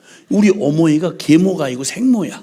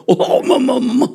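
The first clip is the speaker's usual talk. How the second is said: imitating eager boy; 8 semitones higher than usual. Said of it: "이러고 자랑할".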